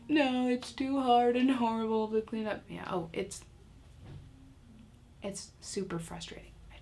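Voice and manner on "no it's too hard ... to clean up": hammed-up child complaint; whining child. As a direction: whine it like an overwhelmed kid. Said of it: "they act like".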